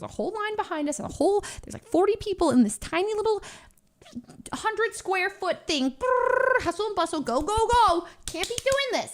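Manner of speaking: high, flustered rush of words; frantic. Real, not acted. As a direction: sound overwhelmed by the crowd. Frantic description of the packed shop.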